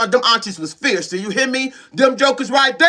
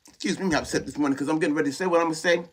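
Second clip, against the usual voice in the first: hurried aside; 5 semitones lower, 6 dB quieter, much faster than usual. Real, not acted. Quick aside to the church.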